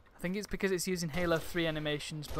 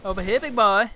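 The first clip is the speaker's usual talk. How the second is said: loud, raised-pitch taunt; shouted come-and-get-me; calling out; 4 semitones higher, 12 dB louder than usual. Pretend. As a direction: holler it at an opponent across the map. Taunting an enemy in game.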